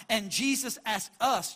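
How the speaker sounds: normal talking voice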